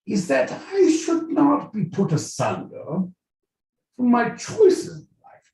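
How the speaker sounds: high-pitched mock royal British; royal British accent. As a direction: proclaim it in a put-on regal British accent. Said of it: "in the royal British accent".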